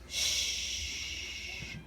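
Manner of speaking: "shh" as one long unvoiced hush; isolated sh sound. Shown as a demonstration. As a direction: hold "shh" on a steady breath. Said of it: "S H go together to say".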